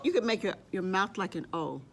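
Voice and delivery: normal talking voice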